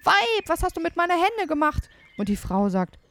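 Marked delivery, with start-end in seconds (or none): 0.0-1.9 high, agitated giant's cry; urgent, high-pitched storybook shout; giant calling out. A narrator's reading. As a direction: call out in alarm as the angry giant. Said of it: "hört er ihn rufen".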